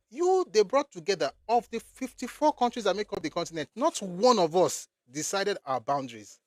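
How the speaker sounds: high, loud and indignant; heated rant. Real, not acted. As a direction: hammer home the outrage. Angry rant about colonial borders.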